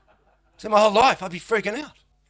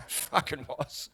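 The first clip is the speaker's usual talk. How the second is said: slow dazed reply; 3 dB quieter, much slower than usual. Retold or imitated. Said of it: "I went".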